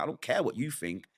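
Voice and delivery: defiant. Retold or imitated. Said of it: "I'm like".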